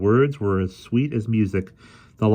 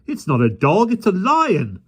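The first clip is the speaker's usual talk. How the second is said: loud rising alarm; 12 semitones higher, 5 dB louder than usual. A narrator's reading. Policeman tells children it is a lion, loud alarm. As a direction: announce the danger.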